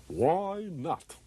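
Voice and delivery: high, drawn-out comic catchphrase; Dayton Allen. Retold or imitated. Comedian tossing out his funny tagline, comic catchphrase voice. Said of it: "noted for saying occasionally and incongruously".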